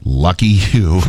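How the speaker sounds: ordinary delivery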